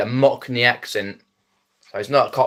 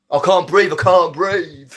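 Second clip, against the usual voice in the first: higher, louder, breathless gasping plea; Gemma Collins impression; 6 semitones higher, 3 dB louder than usual. Imitating the big lady from the gambling adverts, breathless. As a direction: mimic a celebrity panting in a TV ad. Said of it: "and she says".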